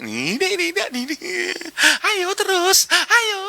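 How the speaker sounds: very high, whiny mock urging with a crying edge; mock panicky Jesus. Preacher mocks a wrong picture of Jesus, whiny and tearful. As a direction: parody a frantic, tearful cheer-on. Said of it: "terus dia gak ngene".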